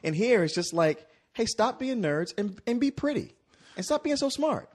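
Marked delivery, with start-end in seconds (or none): 1.3-4.7 mocking the film. Mocking the film's message.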